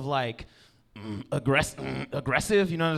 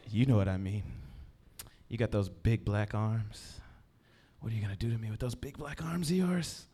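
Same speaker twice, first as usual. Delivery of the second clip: mock sultry whisper; 8 semitones lower, 8 dB quieter than usual. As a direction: coo it at him like a come-on. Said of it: "she, in a whisper".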